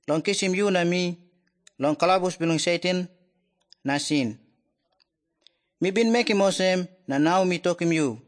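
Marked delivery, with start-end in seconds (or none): none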